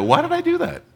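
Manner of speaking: very high squeaky self-rebuke; self-exasperated squeak. Retold or imitated. Preacher mocking his own needless sorry, very high squeak. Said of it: "and then I go".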